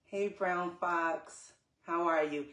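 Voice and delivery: ordinary talk, nothing marked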